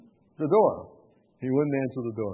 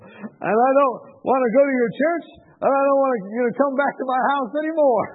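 high, loud retold protest; indignant rejection; 9 semitones higher, 7 dB louder than usual. Man bars visitor from his house, imitated indignant rejection.

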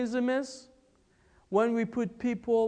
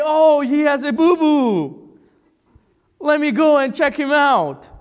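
higher, louder mock-sweet fuss; mock naive concern; 6 semitones higher, 9 dB louder than usual. Preacher mocks naive kindness in war, high and loud. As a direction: play it like a silly cooing nurse. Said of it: "Or you don't say".

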